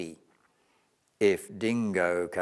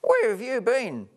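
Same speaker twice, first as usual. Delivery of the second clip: impatient complaint; 12 semitones higher, 5 dB louder than usual. Acted. Hungry koala complains.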